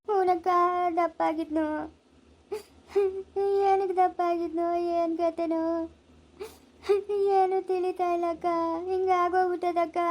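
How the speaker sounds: tearful lament, words drawn into a wail; bereaved mother's helpless weeping; crying. Acted. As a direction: pour out your grief through tears. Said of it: "ಅಳ್ಬೇಡ".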